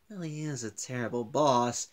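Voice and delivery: quietly coming round to the idea; reluctant agreement. Retold or imitated. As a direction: give in quietly. Retold character giving in.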